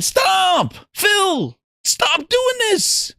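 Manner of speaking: high, slow whiny plea; hammed-up crying fan begging Phil; mock crying plea. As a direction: beg him to stop, fake-tearful. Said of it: "He was crying, saying".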